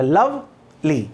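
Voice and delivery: "Lovely" very slow, लव then ली; two drawn-out beats on "lovely"; syllable by syllable. Shown as a demonstration. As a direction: split "lovely" with a long pause before ली. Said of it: "एक syllable ये हो गया".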